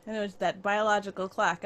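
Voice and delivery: ordinary talk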